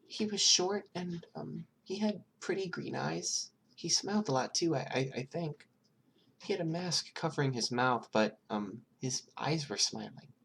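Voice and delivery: put-on high, timid small child voice; scared child answering a detective, high and hesitant; little girl voice. Eri describes Mastermind.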